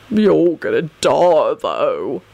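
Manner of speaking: slow threat sinking very low; ominous rumble. A narrator's reading. Baldy threatens Sirius with death, ominous rumble. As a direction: rumble it slowly, dropping deep. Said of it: "Baldy rumbled ominously".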